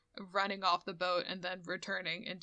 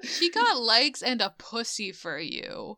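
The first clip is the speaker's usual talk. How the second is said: indignant outburst; 3 semitones higher, 7 dB louder than usual. Retold or imitated. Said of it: "Golan's like".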